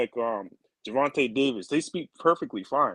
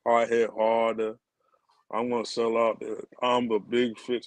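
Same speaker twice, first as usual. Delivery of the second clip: sleepy Eeyore talk; 2 semitones lower than usual. Retold or imitated. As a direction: drag the words out like someone half asleep. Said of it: "The guy talks so".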